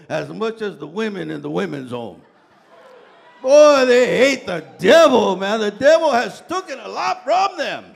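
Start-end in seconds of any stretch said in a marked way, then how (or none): 3.4-5.6 booming sermon shout; preaching shout. Real, not acted. 5.6-7.9 loud, high preacher cry; booming sermon shout; preaching shout. Real, not acted. Preaching litany about the devil.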